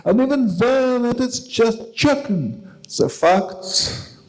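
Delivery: measured, slow poem recital with long pauses; poetry reading. Acted. Poet reading his translation to the evening audience, solemn recital. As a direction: read the poem to the audience, weighty and deliberate.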